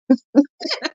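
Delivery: high breathless laugh. Real, not acted. Laughs after the joke.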